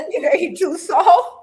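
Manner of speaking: high, loud, slow words through laughter; real laughing agreement. Real, not acted. Co-host echoing the joke about Caillou, laughing. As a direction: agree while cracking up.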